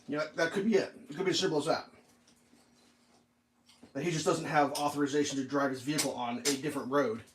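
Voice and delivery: normal talking voice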